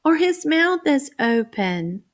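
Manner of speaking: imitating child answers. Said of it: "I think they'll say".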